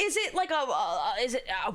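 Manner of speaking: ordinary talk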